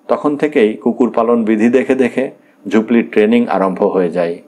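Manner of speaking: ordinary delivery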